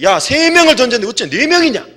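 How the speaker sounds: high, startled exclamation of the king; astonished king. Acted. Preacher voicing Nebuchadnezzar staring into the furnace, astonished. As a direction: blurt it out in disbelief.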